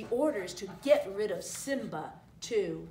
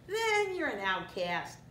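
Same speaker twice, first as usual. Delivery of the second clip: higher, slow, drawn-out cartoon cry; meerkat crying out; 4 semitones higher, slower than usual. A narrator's reading. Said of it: "cried the mercat".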